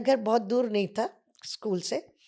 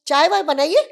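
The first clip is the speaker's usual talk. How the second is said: booming request; 7 semitones higher, 10 dB louder than usual. Retold or imitated. Ray orders tea.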